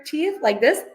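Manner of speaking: "teeth like this" said with tongue between the teeth; a lisped "this" with the tongue poking out; lisp demo. Shown as a demonstration. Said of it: "kids produce their S's and Z's between their".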